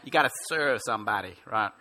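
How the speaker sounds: lightly imitated American singer's accent and lilt; a touch of a drawn American folk-singer accent. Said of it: "say it with that lilt and that accent".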